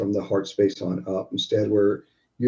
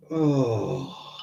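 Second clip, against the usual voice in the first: audible exhale sigh; 5 dB quieter than usual. Shown as a demonstration. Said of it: "we let there be that sigh".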